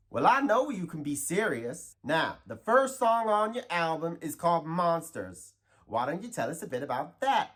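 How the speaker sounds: interview in a hammed-up Texas accent. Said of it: "talk in your normal Texas accent".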